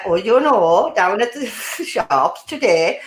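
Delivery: sing-song Welsh, slow and drawn out; drawn-out Welsh imitation with a chuckle; Welsh accent. Shown as a demonstration. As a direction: lilt slowly through it like a Welsh speaker. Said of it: "sing-songy".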